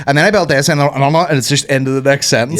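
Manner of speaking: loud, fast, run-on presenter; words tumbling over each other; mock YouTuber rushing. Parodying a fast Lego YouTuber, loud rushed voice. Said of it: "So I'd be like".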